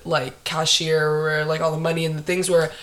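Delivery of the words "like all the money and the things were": usual speaking voice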